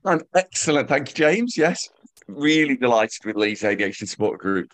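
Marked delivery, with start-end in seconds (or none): none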